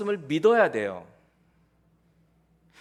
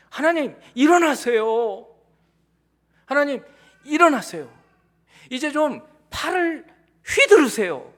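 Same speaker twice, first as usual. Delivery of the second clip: urgent prayer; 6 semitones higher, 7 dB louder than usual. Retold or imitated. Psalmist's urgent prayer voiced.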